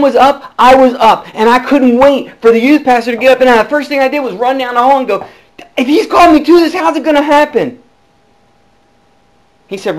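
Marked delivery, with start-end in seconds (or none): 5.8-7.8 higher, louder, eager asking; urgent question. Retold or imitated. Running down the hall to ask the youth pastor, urgent and raised. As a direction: burst out with the question.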